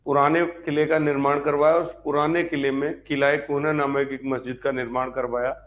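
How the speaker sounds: ordinary talk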